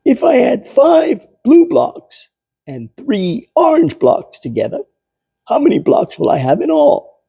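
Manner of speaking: put-on high, bright quizzing child voice; high eager schoolboy asking a math question; eager kid Pete. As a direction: play the keen boy setting a sum.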